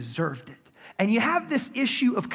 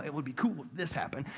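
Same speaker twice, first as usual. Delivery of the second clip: a softer, much quieter aside as Jonah; mock quiet musing; 7 semitones lower, 11 dB quieter than usual. Jonah privately hoping the city gets destroyed, muttered quietly. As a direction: murmur it like a smug thought. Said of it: "He's probably, you know".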